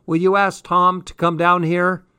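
loud, high call to mom; yelling upstairs. A narrator's reading. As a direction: yell up the stairs. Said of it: "I heard her yelling up the stairs".

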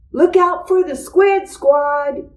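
high excited yell. A narrator's reading. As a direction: shout a warning to a friend mid-game. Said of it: "yelled Buster".

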